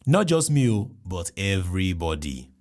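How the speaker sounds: usual speaking voice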